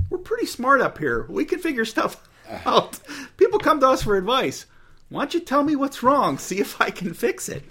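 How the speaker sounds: high, chirpy, self-assured woman's voice; confident wise woman. Retold or imitated. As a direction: sell her town's cleverness.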